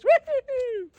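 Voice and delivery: high, playful woo-hoo; real cheerful holler; celebratory whoop. Real, not acted. Celebrating Christmas.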